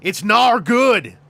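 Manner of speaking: high, loud, slow Australian scolding; hammed-up Aussie mate calling out a warning; broad Australian accent. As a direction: stretch it out in a cartoonish Australian accent. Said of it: "a friend in his life who goes like".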